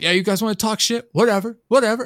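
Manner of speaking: high-pitched mock street rant; imitated heckler yelling. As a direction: mimic a man picking a fight from afar. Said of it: "this guy was yelling at us".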